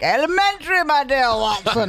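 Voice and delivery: high, theatrical detective greeting; Sherlock Holmes. Retold or imitated. Husband greeting wife as she walks in, as a hammed-up Sherlock. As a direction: mimic a husband grandly playing detective, pitched well up. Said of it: "He comes home, like, I walk in, he's like".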